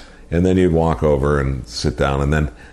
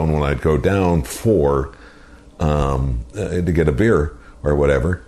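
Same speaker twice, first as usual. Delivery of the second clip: usual speaking voice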